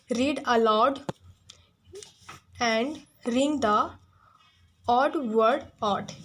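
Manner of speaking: slow, deliberate dictation; reading slowly. Shown as a demonstration. Teacher dictating the exercise instruction to students. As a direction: read the instruction word by word. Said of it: "reading the exercise instruction".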